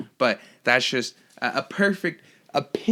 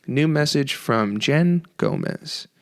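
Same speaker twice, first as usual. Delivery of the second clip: ordinary delivery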